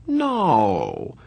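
long, falling disbelieving no; dumbfounded drawl. A narrator's reading. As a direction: draw it out in stunned disbelief.

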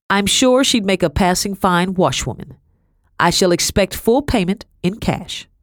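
name your terms as a mistress selling a servant; lightly voiced haughty Madame, businesslike. A narrator's reading.

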